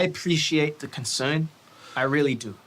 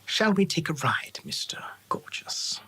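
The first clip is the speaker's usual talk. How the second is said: whispered elf; 3 semitones higher than usual. Acted. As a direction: lean in and breathe the question. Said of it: "the elf turns to him and goes".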